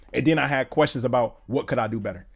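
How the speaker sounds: ordinary talk, nothing marked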